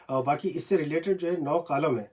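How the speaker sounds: ordinary delivery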